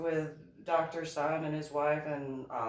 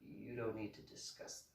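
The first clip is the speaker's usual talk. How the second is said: hushed warning; 4 semitones lower, 12 dB quieter than usual. Retold or imitated. Table warns her quietly.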